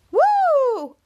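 high loud whoop; cheer. Real, not acted. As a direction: cheer at the end. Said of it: "cheering at end of recap".

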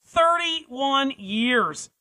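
each word drawn out heavily, falling; slow dramatic emphasis. Real, not acted. Host stressing the long prison term, very slow and heavy. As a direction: let "thirty-one years" sink in slowly.

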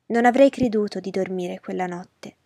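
plain reading voice

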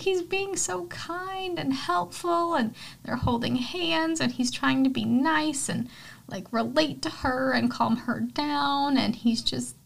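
high, drawn-out gushing; melting with fondness, words stretched out; swooning. Real, not acted. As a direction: coo over someone adorable. Swooning over a kind character.

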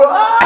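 very high "aah"; mock scream. Storyteller imitating the woman about to be hit, mock scream. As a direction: imitate her scream at the oncoming car. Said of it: "og hun".

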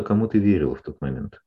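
ordinary talk, nothing marked

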